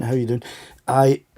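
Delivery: usual speaking voice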